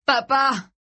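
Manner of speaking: high, whimpering call to Dad; tearful cry. A narrator's reading. Boy starting to weep over his father, tearful cry. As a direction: wail it tearfully. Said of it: "larmoya-t-il".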